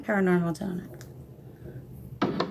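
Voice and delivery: talking with something in the mouth; words round a bite of food; mouth full. Real, not acted. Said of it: "I got something in my mouth".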